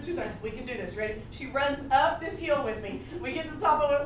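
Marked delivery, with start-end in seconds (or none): 0.4-1.2 low, soft imitated pep talk; quiet encouragement. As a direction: coax softly, like a steady friend. Friend encourages crying runner.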